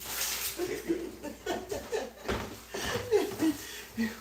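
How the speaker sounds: long airy helpless laugh; breathy laughter. Real, not acted. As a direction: laugh silently, almost wheezing. Laughs off-mic at family.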